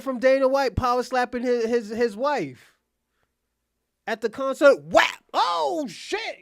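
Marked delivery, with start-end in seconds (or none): 4.8-5.2 sharp loud whap of a slap; loud slap sound effect. Retold or imitated. 5.3-6.4 high, loud burst of surprise; mock shocked oh shit. Reacting to the retold slap.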